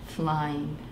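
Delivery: lively, animated read-aloud voice; reading to children. A narrator's reading. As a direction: tell it like a bedtime picture book. Reads picture book aloud.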